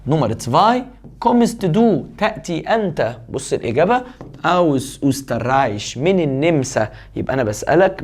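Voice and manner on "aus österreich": slow dictation. Shown as a demonstration. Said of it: "slow dictation of the answer".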